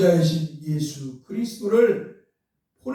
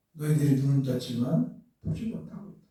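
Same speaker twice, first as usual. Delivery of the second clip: low, softer reproach; retold rebuke; 7 semitones lower, 6 dB quieter than usual. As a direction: retell the rebuke quietly, pitched well down. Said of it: "책망하는 거예요".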